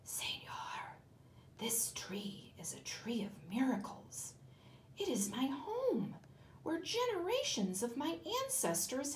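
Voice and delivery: hushed snake whisper; a creature whispering in a sleeper's ear. Acted. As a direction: breathe it into his ear. Boa whispers to sleeping man.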